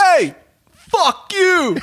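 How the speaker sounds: high, drawn-out heckle; mock tough-guy shout; street-corner yell. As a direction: holler it across the street. Acted stoop guys yelling at passers.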